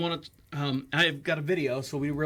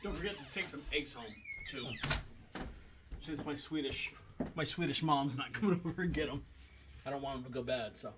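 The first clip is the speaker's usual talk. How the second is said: quiet aside; 3 semitones lower, 10 dB quieter than usual. Real, not acted. Off-mic aside.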